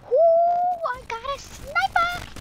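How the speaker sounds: very high, drawn-out "ooh"; high-pitched thrilled exclamation; excited squeal. Real, not acted. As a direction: squeal with delight at a lucky find. Excited find in game.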